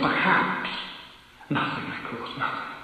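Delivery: plain reading voice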